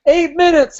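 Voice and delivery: high, loud call. Retold or imitated. Spectator calling the time, loud. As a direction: shout the time as he passes. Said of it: "he changes it as I pass him".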